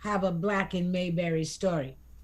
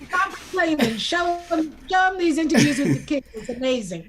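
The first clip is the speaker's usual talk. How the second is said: teasing push; 5 semitones higher, 4 dB louder than usual. Real, not acted. Cohost teasing, urging her on.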